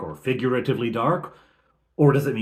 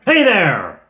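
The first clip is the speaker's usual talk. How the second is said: loud, high call down from above; shouting down; 9 semitones higher, 11 dB louder than usual. Retold or imitated. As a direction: yell down the valley to the goblins. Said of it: "Touchberry shouts down".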